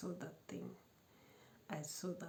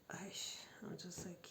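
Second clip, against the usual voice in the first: exasperated whisper; 6 dB quieter than usual. Real, not acted.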